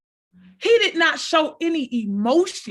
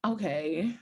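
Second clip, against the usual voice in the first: dry quiet ask; 6 semitones lower, 6 dB quieter than usual. Retold or imitated. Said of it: "I was like".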